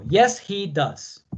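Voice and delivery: drawn-out, careful answer; teacher modelling a short answer very slowly; very slow model answer. Shown as a demonstration. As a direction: stretch every word for the class. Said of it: "Respondemos positivo".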